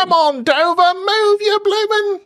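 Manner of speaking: screechy high Cockney cheer, drawn out; hammed-up Cockney, stretched and very high; Eliza Doolittle. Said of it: "I always think of Eliza Doolittle".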